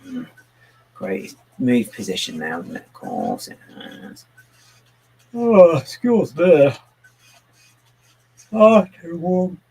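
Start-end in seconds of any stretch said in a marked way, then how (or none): 5.3-9.6 talking through a big yawn; slow, loud, higher words stretched by a yawn; real yawning. Real, not acted. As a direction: let the yawn swallow the words. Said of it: "I start yawning everybody again".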